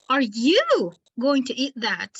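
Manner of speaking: "you" punched and stretched, the rest falling; stress on YOU. Shown as a demonstration. Teacher claims the food is hers, stress on "you". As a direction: hit "you" hard in challenge. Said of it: "It's mine, it's not yours".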